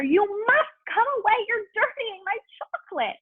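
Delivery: hop-about panic in the voice; high frantic wail; frantic cry. A narrator's reading. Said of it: "cried Mister Wonka, hopping up and down".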